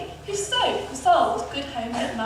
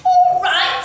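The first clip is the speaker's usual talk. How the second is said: high, loud indignant cry; Cindy's loud, high retort; 11 semitones higher, 8 dB louder than usual. A narrator's reading. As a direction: throw it back as a raised outcry. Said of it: "cried Cindy".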